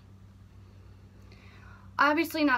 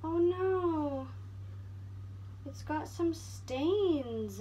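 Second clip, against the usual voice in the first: higher, rising and falling "oh no"; dismayed surprise; 6 semitones higher, much slower than usual. Real, not acted. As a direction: react to finding a stain. Finds stains on the jacket.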